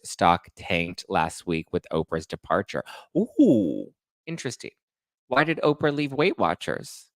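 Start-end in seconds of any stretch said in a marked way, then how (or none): none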